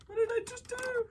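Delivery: high-pitched dismay at own mishap; oh-no, what-have-I-done wail. Real, not acted. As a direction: squeak it out, having just broken something precious. Has just broken son's Lego build.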